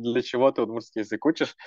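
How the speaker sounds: ordinary talk, nothing marked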